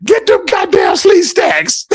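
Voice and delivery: high-pitched, drawn-out mock scream; comic falsetto yell, slowed down; imitated scream. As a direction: squeal it out like the screaming brother. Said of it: "he screamed out".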